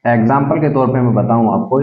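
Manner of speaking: usual speaking voice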